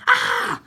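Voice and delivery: sudden high-pitched cartoon squeal; a quick yelp way up high. Alien's head exploding in the spaceship, high squeal.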